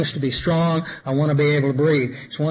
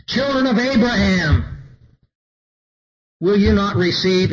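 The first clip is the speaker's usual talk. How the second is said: scripture reading, rising. Acted. Preacher reading the Lord's appeal to his people, raised and pleading. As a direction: lift it up and plead it.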